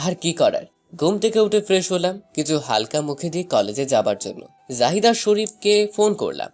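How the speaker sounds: plain reading voice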